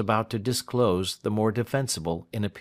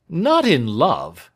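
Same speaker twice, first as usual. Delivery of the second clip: loud, higher, drawn-out disbelief; startled cry; 7 semitones higher, 9 dB louder, slower than usual. A narrator's reading. Lawyer interrupting his client's confession, startled cry. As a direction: cry out the question in alarm. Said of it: "cried Snitchey".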